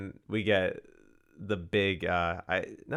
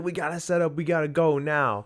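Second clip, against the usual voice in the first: high-pitched, raised-voice rallying; urgent; 8 semitones higher, 5 dB louder than usual. Acted. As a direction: rush the crew before the train comes. Acted director rushing the crew.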